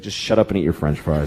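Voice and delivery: low, tired mom voice; weary mother brush-off. Retold or imitated. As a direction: mutter it like a drained mother. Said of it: "she's like".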